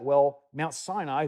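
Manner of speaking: ordinary talk, nothing marked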